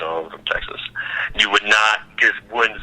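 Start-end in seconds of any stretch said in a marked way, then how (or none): 0.0-0.8 whispered, barely there; mock him mumbling under his breath; whispered mock reply; mumbled reply. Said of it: "you just".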